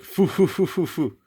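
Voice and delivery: high, puffed character laugh; spaced-out mock laugh, stepping down; imitated chuckle "fu fu fu". Showing viewers the manga character's reaction, mock chuckle. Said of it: "So he's like".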